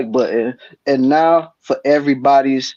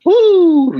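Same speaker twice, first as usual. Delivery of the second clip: excited whoop; 5 dB louder than usual. Real, not acted. Host hypes the show intro.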